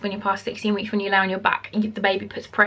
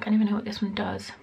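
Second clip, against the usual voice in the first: quick unvoiced whisper to herself; whispered aside; 5 dB quieter, faster than usual. Real, not acted. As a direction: mutter it under your breath, fast. Aside while clicking a link.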